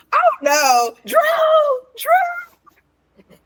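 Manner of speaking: high, desperate calling out; yelling a name. Retold or imitated. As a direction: call out across the room, high. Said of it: "started yelling out Drew's name".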